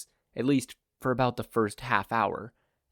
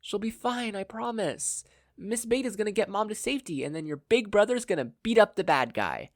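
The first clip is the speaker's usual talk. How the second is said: much higher, promising and confident; reassuring big brother; 11 semitones higher, 3 dB louder than usual. Acted. Big brother promises his sister, reassuring. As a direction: cheer up a crying kid.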